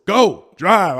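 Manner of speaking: high, loud command; re-enacted urgent shout. Nephew ordering aunt to drive off, shouted urgently.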